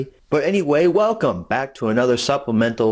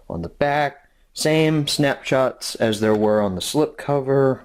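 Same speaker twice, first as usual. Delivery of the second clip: usual speaking voice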